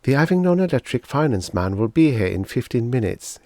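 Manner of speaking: plain reading voice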